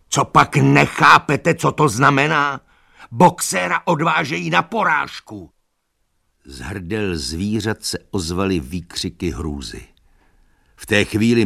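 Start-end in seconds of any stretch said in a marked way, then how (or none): 0.1-5.5 high, loud, urgent outburst; desperate shouting. A narrator's reading. Donkey tells animals about slaughter, desperate shouting. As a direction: shout to make them understand.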